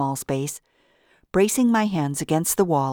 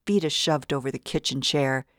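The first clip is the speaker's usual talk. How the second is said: plain reading voice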